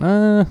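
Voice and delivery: high startled uh; a speechless high uh; stunned grunt. Retold or imitated. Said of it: "And I went".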